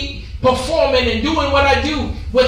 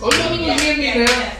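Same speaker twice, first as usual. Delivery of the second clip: usual speaking voice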